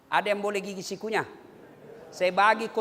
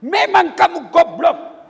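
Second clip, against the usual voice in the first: shouted insult, louder and slow; angry insulting wife; 6 semitones higher, 9 dB louder, slower than usual. Pretend. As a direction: bentak suami dengan kasar. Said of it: "maki-maki, menghina suami". (istri memaki suami dengan teriakan keras)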